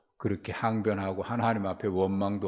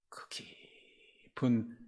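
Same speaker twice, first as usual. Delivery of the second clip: very slow, breathy near-whisper; hushed, lingering draw on the word; drawn-out emphasis; 2 semitones higher, 4 dB quieter, much slower than usual. Real, not acted. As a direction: stretch the word out slowly under the breath. Preacher dwells on deep struggle in prayer.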